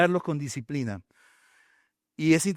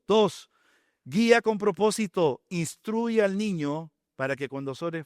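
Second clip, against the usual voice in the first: usual speaking voice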